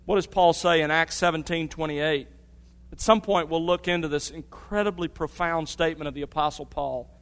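booming preacher building conviction; full-force preaching. Acted. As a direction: drive the point home to the congregation.

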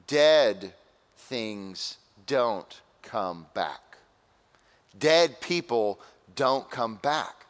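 very slow, word-by-word emphasis; solemn emphasis. Real, not acted. Preacher stresses to congregation that death is final, slow and emphatic. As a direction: weigh each word with a pause between. Preacher drives point home slowly.

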